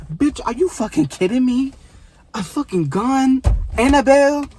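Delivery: can't believe the twist, cry it out; outraged disbelief. Real, not acted.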